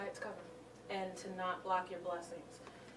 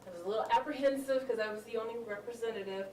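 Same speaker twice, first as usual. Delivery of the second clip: ordinary talk, nothing marked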